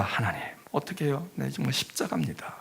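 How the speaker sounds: soft, whispered prayer; hushed plea. Retold or imitated. Spouse quietly praying over the family, hushed. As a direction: murmur it like a burdened spouse.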